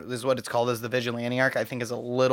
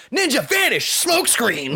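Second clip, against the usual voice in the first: anime battle cry; 8 semitones higher, slower than usual. Retold or imitated. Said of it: "he's just like".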